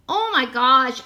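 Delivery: ordinary talk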